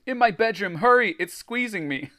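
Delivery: high, loud, urgent plea; choked cry. A narrator's reading. Greg strangled by the blob, choked urgent cry. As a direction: plead as if being squeezed. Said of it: "Greg choked".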